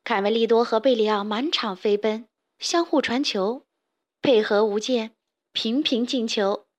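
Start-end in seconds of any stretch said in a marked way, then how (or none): none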